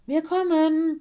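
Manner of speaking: loud, high call across the rushing water; barking call. Acted. Mino calls loudly to the stranded wildcat. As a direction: bark it out loud to a friend. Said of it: "bellte Mino".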